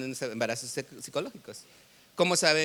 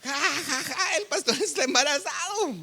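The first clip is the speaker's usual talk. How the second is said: high-pitched hammed-up jeer with fake laughs; mock cackling, squealed taunt; imitated mocking laughter; 11 semitones higher than usual. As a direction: mimic youths laughing at the pastor, voice very high. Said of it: "Así se rieron los jóvenes".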